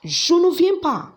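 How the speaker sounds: high, emphatic refusal; a bright, high-pitched no, as if answering the question. Shown as a demonstration. Teacher acts out the reply.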